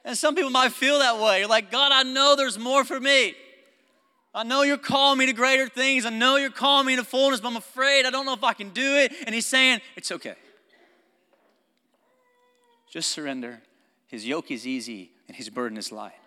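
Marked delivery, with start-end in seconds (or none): 1.7-9.1 high, loud cry to God; impassioned plea. Acted. Believer pleading with God despite being afraid, impassioned. Voicing an anxious believer's prayer. 10.0-16.1 softer, slowing, settling calm; gentle reassurance. Acted. God reassuring a frightened believer, gentle and calm. Voicing God's reassuring answer.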